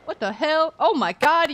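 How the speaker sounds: ordinary talk, nothing marked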